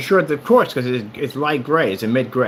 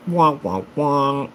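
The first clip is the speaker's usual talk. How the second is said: mock sad trombone; much slower than usual. Mocking missed launch date.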